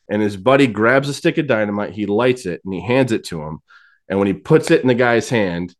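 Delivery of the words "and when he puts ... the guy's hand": usual speaking voice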